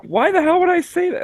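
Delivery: very high, disbelieving; incredulous whine. Retold or imitated. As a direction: squeal it at yourself. Exasperated at himself.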